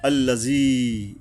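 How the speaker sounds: আল্লাযী with the ী held one alif long; elongated ya. Shown as a demonstration. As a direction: stretch the ী in আল্লাযী for one count. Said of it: "এই ইয়ার উপরে এক আলিফ টানতে হবে".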